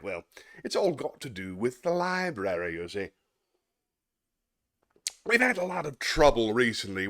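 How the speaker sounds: a stuffy schoolmaster addressing a parent; prim, self-satisfied headmaster voice; pompous headmaster. Acted. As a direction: play the smug headmaster lecturing the father. Reads Atkinson headmaster sketch aloud.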